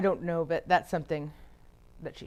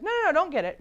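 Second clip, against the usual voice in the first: mock dismissal; 11 semitones higher, 7 dB louder, faster than usual. Acted dad waving off son.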